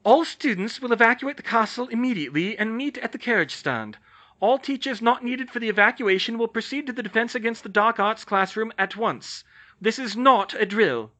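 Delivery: loud, high, stern castle-wide order; loud announcement. Acted. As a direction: announce the evacuation over the whole castle. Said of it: "McGonagall's voice sounded loudly overhead".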